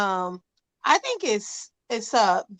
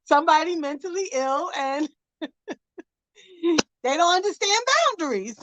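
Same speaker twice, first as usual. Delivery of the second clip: laughing; 6 semitones higher than usual. Real, not acted. Laughs while telling.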